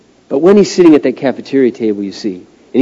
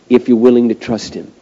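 normal talking voice

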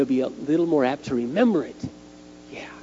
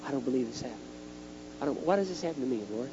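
hushed, disbelieving complaint to God; much quieter, private prayer of dismay; disbelieving inner thought; 2 semitones lower, 10 dB quieter, faster than usual. Retold or imitated. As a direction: softly question it to yourself. I'm sitting there thinking.

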